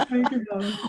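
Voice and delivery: ordinary talk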